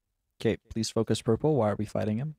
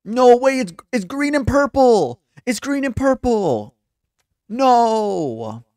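high, loud cry of disbelief; big drawn-out high exclamation; dramatic outcry; 12 semitones higher, 8 dB louder, slower than usual. Pretend. Mock-dramatic reaction to green and purple.

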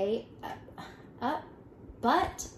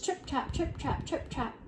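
high, soft hoof patter; light trip-trap; 5 semitones higher, 5 dB quieter than usual. A narrator's reading. Second goat crosses the bridge, light high trip-trap. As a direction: tap across lightly in a high, small voice.

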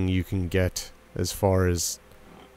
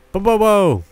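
creature call; 11 semitones higher, 10 dB louder than usual. Pretend. Greets his companion creature.

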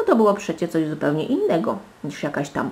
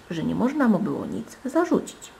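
usual speaking voice

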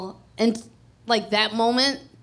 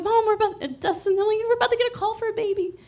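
higher, fast, breathless announcement; excited; 12 semitones higher, 3 dB louder, faster than usual. Retold or imitated. Mother telling her mom and Dustin a baby is coming, excited. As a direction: rush the news out to mom.

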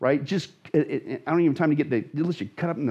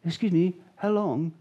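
polite call. Shown as a demonstration. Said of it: "doesn't just mean called out like".

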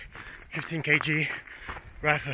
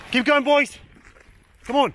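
loud, high yell of encouragement; high rallying shout; 13 semitones higher, 6 dB louder, slower than usual. Real, not acted. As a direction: coach shouting at weary marchers.

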